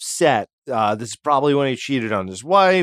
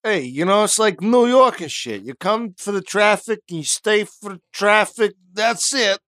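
exaggerated New York accent, much higher; 8 semitones higher than usual. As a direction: ham up a New York wiseguy. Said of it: "why he's from Brooklyn".

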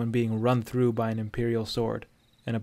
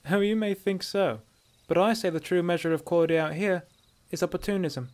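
higher, knowing bandit voice; pitched-up, smug she-elf; sly dark elf woman; 6 semitones higher than usual. A narrator's reading. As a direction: toy with the stranger.

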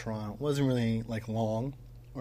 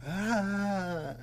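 imitated baby cry; 9 semitones higher than usual. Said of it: "he'll start screaming, crying, and be like".

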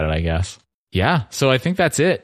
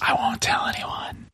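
whispering; 6 dB quieter than usual. Acted. Conspiratorial joke.

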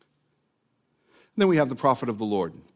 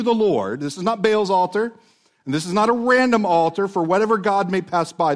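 raised, insistent preaching voice; fervent preaching; 4 semitones higher, 3 dB louder than usual. Acted. Preacher to congregation, fervent raised preaching. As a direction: press the point home.

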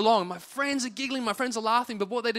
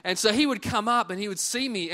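normal talking voice

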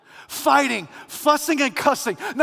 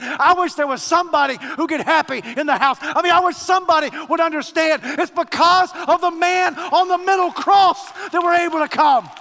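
loud, higher, hammering each "because"; full-force preaching; 5 semitones higher, 4 dB louder than usual. Acted.

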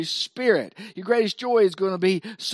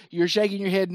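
ordinary talk

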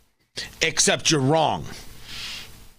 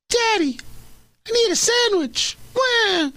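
very high whiny child voice, fake wail; badly faked child crying for food; crying kid impression. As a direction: squeak it out like a hungry little kid. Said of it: "I'm trying to do the impression".

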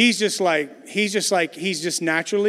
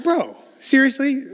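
incredulous; 8 semitones higher, 4 dB louder, slower than usual. Retold or imitated. Dad sees twenty gums.

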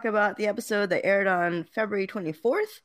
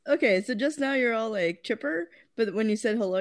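ordinary talk, nothing marked

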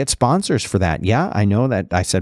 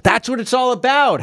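much higher-pitched, enthusiastic interjection; excited exclamation; 14 semitones higher than usual. Real, not acted. Host breaks off reading the email to tell co-host, excited. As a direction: break in with high-pitched approval.

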